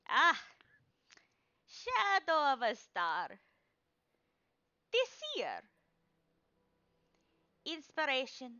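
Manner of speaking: put-on high, loud old woman proclaiming; old crone seer. Crone reading Shadow's card, loud and high. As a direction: cry out each card like a mystic crone.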